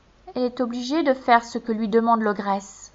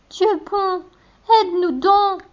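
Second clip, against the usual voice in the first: very high desperate plea; high tearful girl crying out; 10 semitones higher than usual. A narrator's reading. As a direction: beg God in tears. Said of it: "s'écrie-t-elle".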